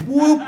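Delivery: high, loud "whoop" imitating a bro; mocking whoop. Bro tweaking a stranger's nipple, loud high mocking whoop. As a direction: whoop it sharply, way up in pitch. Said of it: "he makes that sound and everything".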